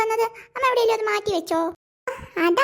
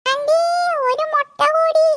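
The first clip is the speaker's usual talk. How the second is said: loud demanding call; 7 semitones higher, 5 dB louder, slower than usual. Acted. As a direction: call out high and loud for more. Boy calling loudly for more eggs. (ഉറക്കെ ഉയർന്ന ശബ്ദത്തിൽ വിളിച്ച് ചോദിക്കുന്നു)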